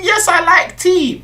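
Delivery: high, mimicking posh English accent; mock someone's put-on posh voice; exaggerated posh accent, pitched right up. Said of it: "About your posh accent".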